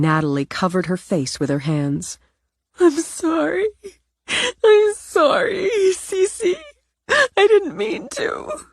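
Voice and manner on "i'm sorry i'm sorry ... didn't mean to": very high, slow, wailing through tears; sobbing apology. Acted. Natalie sobbing apologies to her grandmother, high and slow. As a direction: cry the apology, drawn out.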